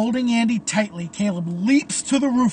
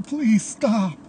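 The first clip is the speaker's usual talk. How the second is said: higher, tearful begging; crying plea; 4 semitones higher, 4 dB louder than usual. A narrator's reading. Andy begs Caleb to stop, crying. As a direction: beg through tears. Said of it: "He's crying".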